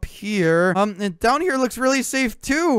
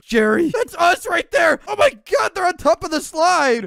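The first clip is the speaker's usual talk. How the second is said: panicked; 7 semitones higher than usual. Acted. Sees monsters, panics.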